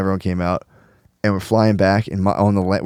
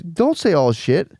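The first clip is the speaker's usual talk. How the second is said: slow, higher-pitched child impression; manners-obsessed little kid; 6 semitones higher, slower than usual. Daughter correcting dad's swearing, mimicked child voice. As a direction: mimic a small child telling off a grown-up. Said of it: "when little kids get, like, real obsessed with manners".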